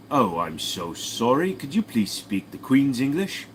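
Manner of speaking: slow, over-polite British English; British accent. Acted. Brit asks Australian to speak properly, British accent. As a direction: apologise slowly in a put-on British accent. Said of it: "argument between a British and Australian".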